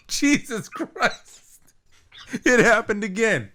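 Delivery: real high-pitched exclamation of shock; startled outburst. Real, not acted. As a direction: blurt it out, drawn out and high, thrown by the interruption. Headphones startle mid-story, crash out.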